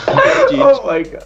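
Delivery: high, slow shocked cry; shocked squeal. Real, not acted. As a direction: gasp it out in disbelief. Reaction to horror.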